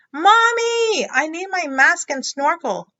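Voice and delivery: put-on pleading child. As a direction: cry out for mommy like a desperate kid. Frog pleading to mother.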